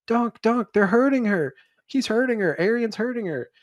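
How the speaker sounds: put-on high, urgent child's cry for help; panicked kid. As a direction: beg for help like a frightened boy. Egg running in panicked.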